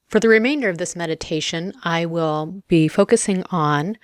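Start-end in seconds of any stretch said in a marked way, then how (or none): none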